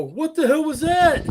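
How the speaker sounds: high, loud mock shock; startled outcry. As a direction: imitate players jolted by a scare. Startled players quoted after scare.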